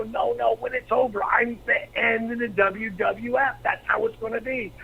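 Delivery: high-pitched, urgent retelling; retold excited declaration; emphatic insistence. Insists to doubting wife.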